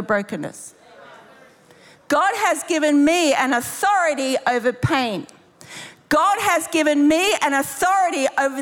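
high, emphatic preaching with conviction; full-force preaching. Real, not acted. Preacher declaring deliverance over the congregation, high and emphatic. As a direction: declare it over them with authority.

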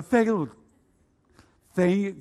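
"Thank you" stuck and garbled, breaking off after "thang"; mouth not working. Retold or imitated. Convert trying to pray "Thank you" as his mouth fails, garbled. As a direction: struggle to get "Thank you" out, the second word failing. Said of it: "my mouth wouldn't work properly".

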